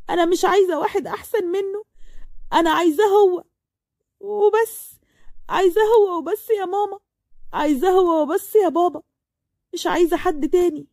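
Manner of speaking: begging her parents through tears; desperate pleading. Acted. Layla begs her parents she wants only Marwan, near-crying.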